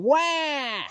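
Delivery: high wailing wah; imitated child's wail. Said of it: "sound like a human child going".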